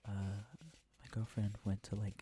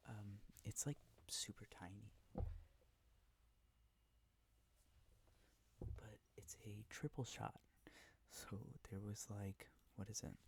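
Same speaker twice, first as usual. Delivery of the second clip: whispering; 9 dB quieter than usual. Real, not acted. ASMR whisper video.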